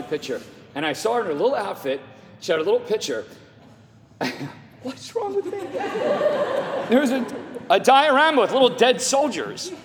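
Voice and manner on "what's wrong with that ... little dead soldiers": high, choked voice breaking into laughter; tearful laughing. Real, not acted. Father describes daughter's history project to congregation, tearfully laughing. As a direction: push the words out through real tears and laughs. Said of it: "I'm gonna cry now".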